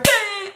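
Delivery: high-pitched imitated ding. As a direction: voice a quick high chime. Imitates light changing.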